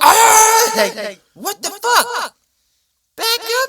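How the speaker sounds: high startled shriek, then a slow what the fuck; startled cry. Retold or imitated. Mailman startled by a nice dog, yelping high. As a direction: jump back and yelp.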